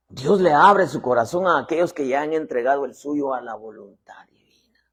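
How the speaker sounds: ordinary delivery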